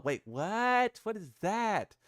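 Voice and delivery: puzzled, rising in surprise; surprised. Real, not acted. Streamer spotting something odd on screen, surprised.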